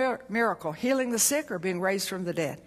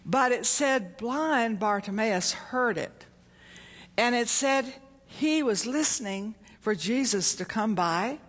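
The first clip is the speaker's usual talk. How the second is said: ordinary talk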